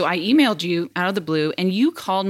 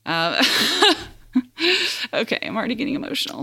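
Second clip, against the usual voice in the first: getting emotional; 4 semitones higher, much faster than usual. Real, not acted. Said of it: "I'm trying not to get emotional".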